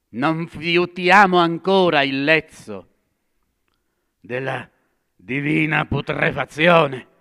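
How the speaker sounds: loud, high cry of horror; outburst. Acted. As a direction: burst out in disgust. Madman bursts out again.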